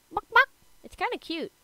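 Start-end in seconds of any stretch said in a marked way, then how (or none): 0.1-0.5 loud, high imitated clucks; chicken clucking. Player voicing a chicken running wild, high loud clucking.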